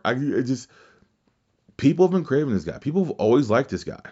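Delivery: ordinary delivery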